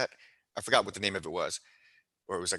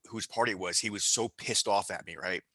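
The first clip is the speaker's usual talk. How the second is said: ordinary talk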